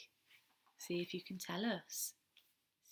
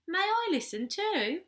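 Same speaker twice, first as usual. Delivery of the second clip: small chick voice; 10 semitones higher, 7 dB louder than usual. Acted. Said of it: "cried Tiny Chick".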